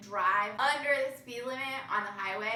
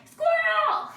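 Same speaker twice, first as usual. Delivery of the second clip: much higher, much louder sudden yelp; excited squirrel shout; 14 dB louder than usual. Retold or imitated. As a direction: blurt it out like you just spotted a squirrel. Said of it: "I'm like".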